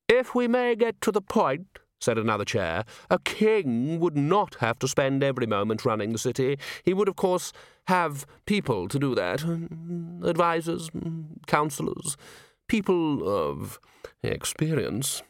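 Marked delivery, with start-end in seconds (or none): 0.1-1.8 steer them pointedly back to business; impatient grand committee member. Acted. A chair interrupts Nobby's brandy talk, high and impatient. 3.1-15.2 pompous committee member. Acted. A chair explains kingship to Nobby, pompously.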